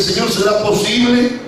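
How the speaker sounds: high-pitched, anguished complaint, long drawn phrases; acted lament, climbing in pitch; tearful pleading. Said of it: "comenzó a llorar y dice".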